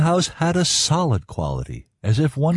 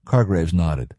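ordinary delivery, nothing marked

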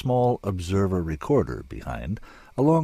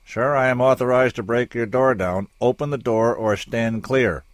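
loud, firm, higher order; stern command; 7 semitones higher, 6 dB louder than usual. Acted. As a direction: soldier threatening to break in, loud. Soldier threatens to break door.

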